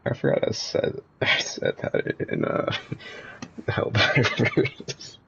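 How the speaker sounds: slow, breaking into laughter; laughing. Real, not acted. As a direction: laugh through the words. Laughing at his own clip.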